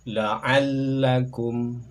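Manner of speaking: La'allakum very slow, ll held; slow shadda demo. Shown as a demonstration. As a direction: draw La'allakum out. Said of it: "contoh pelafalan kami".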